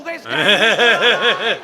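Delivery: a huge laughing shout; the game is saved, yell it out; triumphant roar. Real, not acted.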